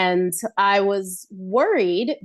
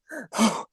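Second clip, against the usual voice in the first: a quick mock gasp for air, a touch softer; imitated gasp; 3 semitones higher, 4 dB quieter than usual. Telling the hosts about winded dancers: mock gasp. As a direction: act out gasping like a winded dancer.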